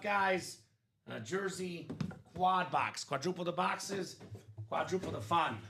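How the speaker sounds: ordinary talk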